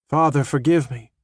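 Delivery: plain reading voice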